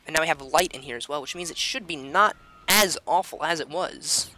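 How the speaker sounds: ordinary talk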